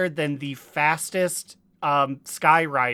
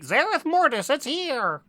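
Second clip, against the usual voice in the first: hype announcer; 10 semitones higher than usual. Retold or imitated. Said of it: "I don't remember Shadowlands being like".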